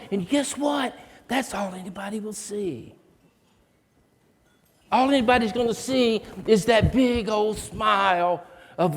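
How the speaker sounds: fervent encouragement. Retold or imitated. Teacher rallying the scared boy before the stage, fervent and rousing. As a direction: pour belief into the boy, building. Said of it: "She said".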